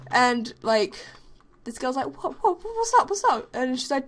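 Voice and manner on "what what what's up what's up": high, flustered repeats; confused squeak. Retold or imitated. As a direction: sound caught off guard. Confused girl turning round.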